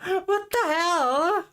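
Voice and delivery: high, slow, drawn-out what; stunned disbelief. Real, not acted. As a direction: gasp it out in disbelief. Stunned by donation.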